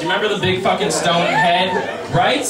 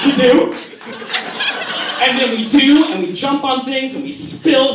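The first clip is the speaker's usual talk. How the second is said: excited; 6 semitones higher than usual. Pretend. Excited retelling of fantasy.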